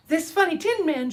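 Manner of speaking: high, tiny royal squeak; a little mouse proudly declaring her rank; proud mouse queen. Acted. Mouse queen voiced in story.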